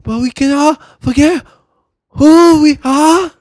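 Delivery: loud, high, slow mock Japanese accent; exaggerated high-pitched Japanese movie accent. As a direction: declaim it haltingly in a hammed-up Japanese accent.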